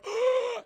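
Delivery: high gasp of shock; hammed-up gasp, as if slashed; mock wounded gasp. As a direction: gasp like a man just cut open. Slashed hero gasps in retelling.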